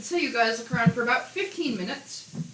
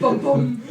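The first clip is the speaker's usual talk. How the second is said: loud, punchy mock sting; TV crime-show sting; 5 semitones higher, 9 dB louder than usual. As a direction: punch out two dramatic booms, playfully. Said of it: "The Law and Order crew".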